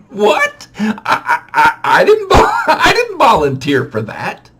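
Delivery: high protest broken by laughs; half-laughing, flustered denial. Retold or imitated. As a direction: laugh through the panic. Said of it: "So I'm like".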